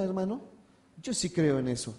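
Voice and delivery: ordinary talk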